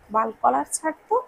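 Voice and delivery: ordinary delivery, nothing marked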